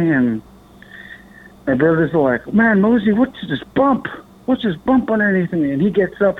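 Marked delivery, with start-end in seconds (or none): 2.5-5.7 high-pitched alarmed questions, imitating brother; freaking out. Brother finding a bump under the tent, imitated freak-out. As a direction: voice a brother's rising alarm in retelling. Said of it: "he's freaking out".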